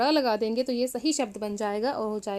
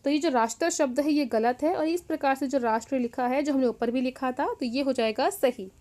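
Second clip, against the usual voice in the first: ordinary delivery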